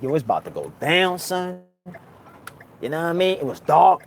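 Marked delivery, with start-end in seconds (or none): 0.0-1.6 low, quiet, calm resolve; inner thought voice. Retold or imitated. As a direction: say it quietly to himself. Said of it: "I'm like".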